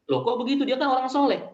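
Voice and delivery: a voice in heaven questioning the son's complaint; surprised. Retold or imitated. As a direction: react in mild disbelief. God answering the complaining son.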